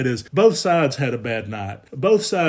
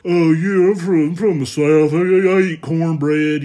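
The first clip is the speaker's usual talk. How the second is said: loud, high, drawn-out mock Southern accent, slower; mocking fake Southern accent; 5 semitones higher, 9 dB louder than usual. New Yorkers mocking the Southern speaker in a loud fake Southern accent. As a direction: ham up a hillbilly Southern accent to mock him. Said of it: "they would put on a fake Southern accent".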